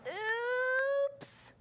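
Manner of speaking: high drawn-out oops; surprised oops. Acted. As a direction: let it slide up in dismay. Reading aloud, dramatic moment.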